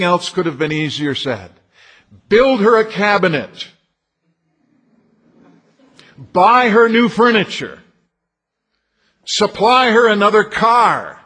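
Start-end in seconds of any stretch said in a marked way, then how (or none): 2.3-11.2 louder and higher, each item pushed harder; rising emphasis. Acted. Preacher listing easier tasks for husbands, rising loud. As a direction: build the list to a ringing peak.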